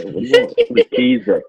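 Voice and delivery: shrill bewildered what keys; confused. Retold or imitated. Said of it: "I'm like".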